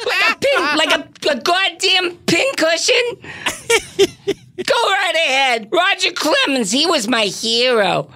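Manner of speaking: high put-on voice, indignant; high comic tirade from a ball-club owner; Marge Schott. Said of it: "Marge Schott missed out on the".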